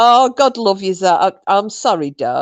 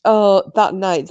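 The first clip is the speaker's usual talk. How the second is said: ordinary delivery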